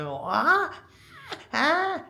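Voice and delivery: high mock crying wail; imitating child's wail. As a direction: imitate a small child wailing. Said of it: "ऐसे चिल्ला रहे थे ना".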